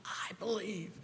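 breathy whispered confession. Retold or imitated. Father whispers his belief to Jesus. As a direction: barely breathe it.

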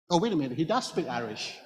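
ordinary delivery

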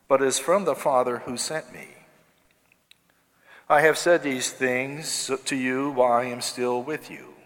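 normal talking voice

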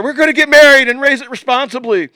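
high, loud excited shout. Retold or imitated. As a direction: yell the promise. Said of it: "I'm shouting at her".

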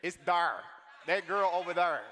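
loud "there" with a long, heavy R; Saint Louis there. Shown as a demonstration. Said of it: "the R in Saint Louis, I'm gonna ride it".

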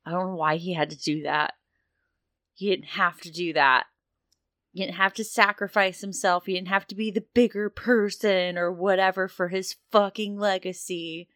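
starts upset, ends high and loud, voice cracking; grief building to breaking. Acted. Sure Shot venting grief for the dead leader, rising to a breaking cry. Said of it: "Sure Shot's just like".

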